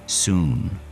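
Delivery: usual speaking voice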